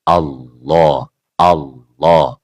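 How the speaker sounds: low Allah with a thick L and O sound; heavy Allah. Shown as a demonstration. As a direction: say Allah thick, like alloh, without rounding lips. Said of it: "Pembunyian lafaz jalalah dengan tebal".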